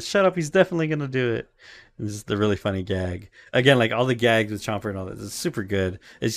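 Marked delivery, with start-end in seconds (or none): 0.0-1.5 insistent Luffy. Retold or imitated. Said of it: "It's like".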